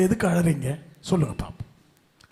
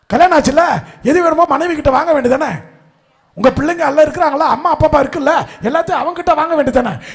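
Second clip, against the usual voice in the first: loud, fast, higher rebuke; fiery preaching; 6 semitones higher, 7 dB louder than usual. Acted. Preacher scolding the crowd for coming to cry, loud and quick. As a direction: drive the question at them hard.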